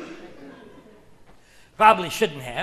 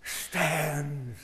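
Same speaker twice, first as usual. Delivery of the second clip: hushed reveal; 8 semitones lower, 13 dB quieter than usual. Acted. Punchline drop.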